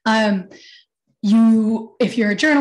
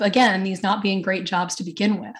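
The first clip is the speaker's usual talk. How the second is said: ordinary talk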